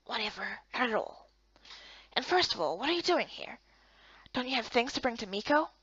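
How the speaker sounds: lightly voiced sly young man; a hushed, laid-back tease; quiet male character.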